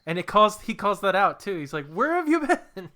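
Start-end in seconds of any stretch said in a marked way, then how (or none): 1.9-2.9 high, slow, drawn-out reproach; mock indignant. Peter confronting his absent dad, high mock indignation. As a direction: stretch it out high in mock outrage.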